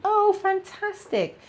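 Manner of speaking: high, drawn-out oh of delight. Real, not acted. Psychic thanks a fan, high delighted gush.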